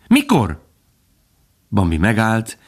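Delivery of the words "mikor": high, eager demand; curious and impatient, pitched high; impatient fawn. A narrator's reading. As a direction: push for an answer at once. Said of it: "kíváncsian és türelmetlenül".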